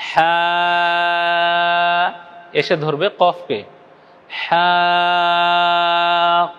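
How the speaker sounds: হা held long and steady; drawn-out vowel. Shown as a demonstration. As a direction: stretch the হা for four counts. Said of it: "আল". (হা চার আলিফ পরিমাণ টেনে বলা)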